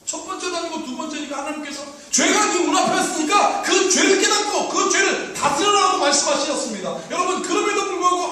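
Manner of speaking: loud, high-pitched preaching; predica accorata. Real, not acted. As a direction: preach it out at full force. Preaches at full force.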